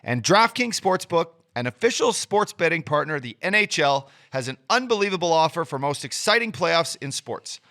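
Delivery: upbeat hyped sponsor read. Acted. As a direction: sell it like a sportscaster. Ad read by host.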